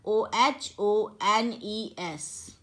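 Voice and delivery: letters named one by one, very slow; slow, deliberate letter-by-letter reading; slow spelling out. Shown as a demonstration. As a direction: spell it out slowly for students. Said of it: "तो अब यहाँ पर देखिए".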